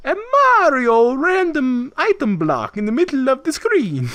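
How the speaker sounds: high, loud, drawn-out announcement; hammed-up big reveal voice; mock hype. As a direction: stretch it out in overblown excitement. Hyped retelling of the reveal.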